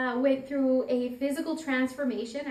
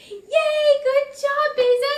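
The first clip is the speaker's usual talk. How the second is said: much higher, loud gleeful cheer; child cheering; 14 semitones higher, 13 dB louder than usual. Retold or imitated. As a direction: cheer your sister's win at the top of your voice. Little girl cheering her sister.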